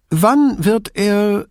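put-on high, slow frail old lady; old woman voice. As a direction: begin the question, then lose it. Old signora starts, breaks off.

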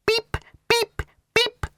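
very high, short electronic pips; imitated beeps. A narrator's reading. Ford copies the speaking clock, beeping. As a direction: mimic phone pips in a high squeak.

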